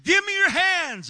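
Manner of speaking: high, loud call; shouted command. Retold or imitated. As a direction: yell it into the manhole. Calling down to the man.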